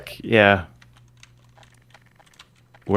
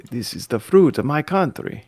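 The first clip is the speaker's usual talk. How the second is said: higher-pitched mimicked Armenian accent; imitated Armenian accent; 6 semitones higher than usual. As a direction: quote him with an Armenian accent. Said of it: "he would say".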